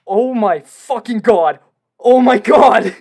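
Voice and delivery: loud, slow, stunned exclamation; shocked. Real, not acted. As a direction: disbelief hitting all at once. Reading shocking email news.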